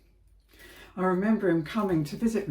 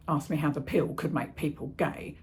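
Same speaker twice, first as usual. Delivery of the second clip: usual speaking voice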